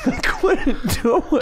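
high, slow, through laughter; can't stop laughing. Real, not acted. Caller admitting he couldn't cancel, laughing.

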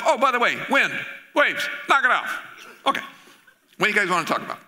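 high, breezy dismissal; casual offhand. Acted. Jesus casually dismissing the storm, breezy and offhand. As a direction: toss it off like nothing.

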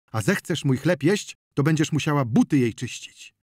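loud, forceful tirade; angry threat. A narrator's reading. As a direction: lay down the law, hammering each threat. Wasil threatens his sister-in-law.

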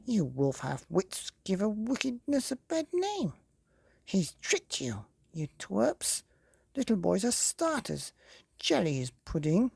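high scornful fairy scolding; wicked fairy voice. Acted. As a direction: sneer at the silly wolves. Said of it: "snarled the fairy".

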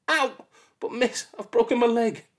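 put-on hurt child. Poked child protests to the teacher, hurt. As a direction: cry out and plead.

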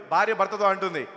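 usual speaking voice

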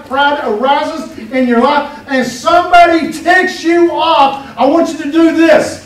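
loud, emphatic sermon delivery; preaching at full force. Real, not acted. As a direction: drive it home to the congregation.

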